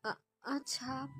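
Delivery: halting, soft and slow with a catch; slow soft tearful stammer. Acted. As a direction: choke out the word softly. Heroine tearful on phone.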